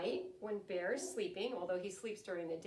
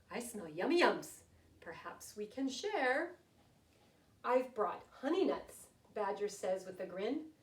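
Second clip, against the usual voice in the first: lively, sing-song picture-book reading, louder than her chat; storytelling to children; 7 dB louder than usual. A narrator's reading. Teacher reading a rhyming bedtime book to her class, lively storytelling. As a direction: read it like story time for little ones.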